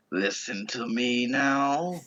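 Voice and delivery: slow, lightly voiced villain line; a hint of the Doc Ock movie villain; Doc Ock impression. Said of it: "just like Doc Ock in Spider Two".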